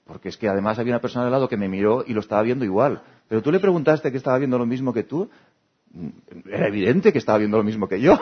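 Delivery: heated argument. Acted. Two people arguing about what was obvious, rising heat. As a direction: push your point harder each time. Acted quarrel over assumptions.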